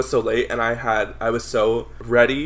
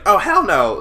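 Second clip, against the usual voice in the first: sassy refusal; 7 semitones higher, 8 dB louder than usual. Retold or imitated. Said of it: "But she was already like".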